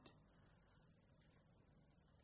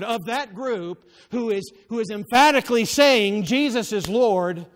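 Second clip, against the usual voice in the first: raised, louder pulpit voice; emphatic preaching; 5 semitones higher, 10 dB louder than usual. Real, not acted. Preacher singles out those saying Jesus is Lord, emphatic preaching. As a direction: press the point hard, voice lifted.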